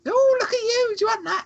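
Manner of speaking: put-on very high, cooing old lady voice to children; imitating Nan Peggy with the kids. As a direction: coo sweetly over the fence. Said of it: "talking over the fence to the two kids".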